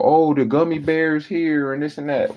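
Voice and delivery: mock the clerk's rambling pitch. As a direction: customer mimicking a chatty dispensary clerk. Mocking a know-it-all clerk.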